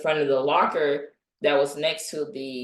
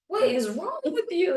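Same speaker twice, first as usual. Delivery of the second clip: baffled; 13 semitones higher than usual. Retold or imitated. Asking Kevin, confused.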